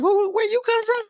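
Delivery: high, incredulous question; surprised. Retold or imitated. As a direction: ask it sharply, way up high. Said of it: "I was like".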